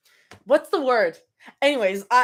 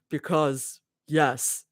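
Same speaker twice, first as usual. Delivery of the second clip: low Abby voice; 7 semitones lower, 5 dB quieter, much slower than usual. Acted. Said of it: "I need to go even lower".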